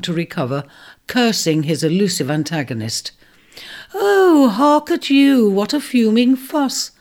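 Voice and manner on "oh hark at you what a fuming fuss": high bright imp voice, louder; light cheery voice. Acted. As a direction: tease him cheerfully from above. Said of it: "called a light, cheery voice".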